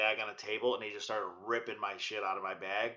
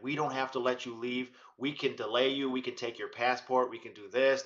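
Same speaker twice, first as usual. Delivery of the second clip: ordinary delivery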